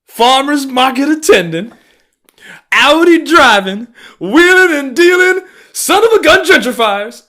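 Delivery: high, very loud string of insults; exasperated high-pitched yelling; ranting shout. Real, not acted. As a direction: bellow each insult as its own burst.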